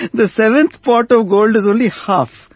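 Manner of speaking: half laughing at the seventh pot; amused emphasis. Retold or imitated. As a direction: relish the punchline with a grin. Repeats punchline, laughing at it.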